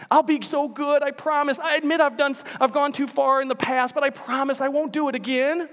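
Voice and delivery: high, wheedling plea; pleading lizard. Acted. Lizard begging the angel for mercy, high wheedling voice. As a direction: beg not to be killed.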